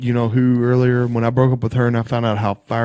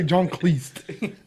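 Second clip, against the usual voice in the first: quoting catchphrase; 2 semitones higher, 5 dB quieter than usual. Retold or imitated. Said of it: "you would always say".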